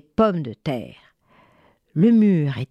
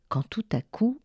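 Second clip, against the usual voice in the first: plain reading voice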